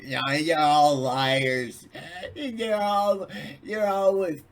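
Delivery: high, loud mocking jab; loud, slow sarcastic jab; mock accusing. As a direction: sneer you're all liars at them.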